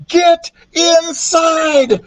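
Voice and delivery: high, slow drawn-out scream; screaming warning. A narrator's reading. Jack screaming to people up ahead, high drawn-out warning. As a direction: scream it to strangers far ahead. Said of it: "he screamed to the people up ahead".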